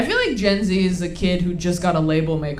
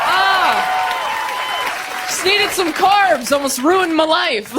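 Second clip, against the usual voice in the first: mock relief; 7 semitones higher, much slower than usual. Relief after eating bread.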